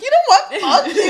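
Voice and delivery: loud, high, half-laughing fury; mock outburst. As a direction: explode in hammed-up anger. Acting out a snapped retort.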